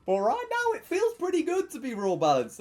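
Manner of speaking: high, loud, gleeful burst; elated. Real, not acted. Streamer boasting to viewers about his balance, elated. Gloating over a good session.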